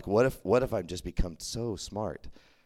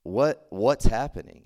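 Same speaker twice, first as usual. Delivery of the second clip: mildly upset; 2 semitones higher, 8 dB louder, slower than usual. Retold or imitated. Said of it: "starting to get angry".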